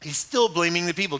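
normal talking voice

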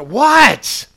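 sudden high, loud yell; exasperated parent. Acted. Parent walking into the child's bedroom, exasperated shout. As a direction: explode as if pestered past all patience.

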